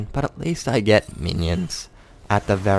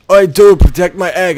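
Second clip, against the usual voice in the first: mock teammate voice; 9 semitones higher, 12 dB louder than usual. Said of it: "And he's like".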